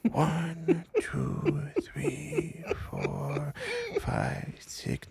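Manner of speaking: breathy whispered count, high and soft. Acted. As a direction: count under your breath to steady yourself. Counting aloud to calm down.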